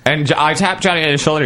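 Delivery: ordinary talk, nothing marked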